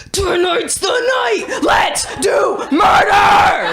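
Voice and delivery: high, slow, drawn-out maniacal shout; bloodthirsty battle cry. Acted. Half of her voicing its urge to murder, hammed-up shout. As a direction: roar it like a gleeful would-be killer.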